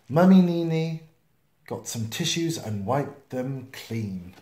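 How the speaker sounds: plain reading voice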